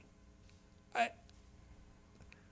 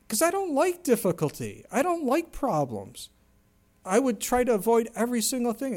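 higher, pressing insistence; emphatic confession; 8 semitones higher, 3 dB louder than usual. Real, not acted. Preacher confessing he avoids hardship, emphatic. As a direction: admit it with feeling.

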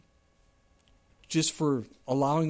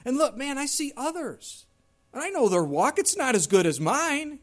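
high, louder indignant grumble; smug complaint; 9 semitones higher, 5 dB louder than usual. Retold or imitated. Preacher voicing a self-righteous believer comparing, high indignant whine. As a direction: puff up with mock self-righteousness.

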